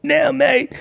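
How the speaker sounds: imitating Michael Peterson. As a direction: mimic a buddy cutting in. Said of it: "Michael Peterson looks at me and he's like".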